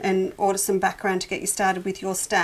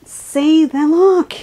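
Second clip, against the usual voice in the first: bursting with real delight, higher and louder; 7 semitones higher, 8 dB louder, much slower than usual. Real, not acted. Thrilled quilter showing viewers the blue fabric, higher and louder. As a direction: gush over the colours as they pop. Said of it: "it's having my colour therapy".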